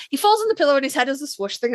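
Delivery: rushed, fast delivery; too fast. Real, not acted. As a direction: rattle it off quickly in one breath. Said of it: "I said that too quickly".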